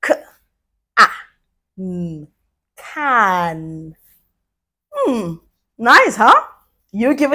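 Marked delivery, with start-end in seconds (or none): 0.0-3.9 each sound said apart, then the word; segmented blending. Shown as a demonstration. Teacher showing kids how to blend, sound by sound. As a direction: pause between K, ah, mm, then say can. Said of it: "Let's blend".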